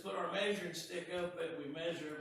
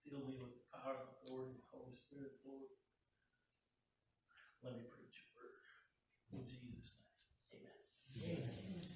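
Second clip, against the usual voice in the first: prayer; 4 semitones lower, 7 dB quieter, faster than usual. Acted. Preacher prays before sermon.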